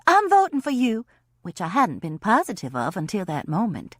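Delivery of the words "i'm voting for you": blabbering. Retold or imitated. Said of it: "I just blabbered".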